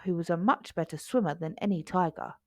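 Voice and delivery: plain reading voice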